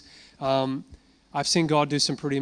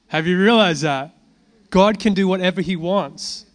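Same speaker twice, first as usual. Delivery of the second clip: louder, rising preacher's declaration; full-force preaching; 2 semitones higher, 7 dB louder than usual. Acted. To the congregation about God's freedom, louder full-force preaching. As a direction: drive it home to the congregation.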